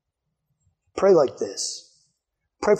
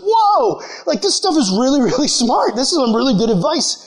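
high-pitched, wowed retelling of a discovery; amazed discovery; 7 semitones higher than usual. Retold or imitated. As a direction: act out being blown away by what you read. Retelling prisoner's amazed first reading.

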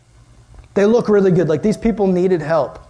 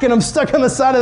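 normal talking voice

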